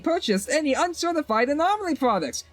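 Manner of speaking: chirpy shopkeeper. Acted. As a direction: voice the cheerful vendor up high. Voices the shopkeeper NPC.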